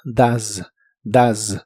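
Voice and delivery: "does" said as "daz", with a short ah vowel and a buzzing z; does with an ah sound. Shown as a demonstration. Teacher showing learners how "does" sounds, modelled clearly. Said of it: "lo pronuncias como si la O fuese una A".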